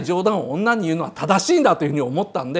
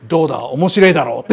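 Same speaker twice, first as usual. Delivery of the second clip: lame joke punchline; 7 semitones lower than usual. Retold or imitated. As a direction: mumble the punchline proudly. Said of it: "こういう下手なジョークの言い方".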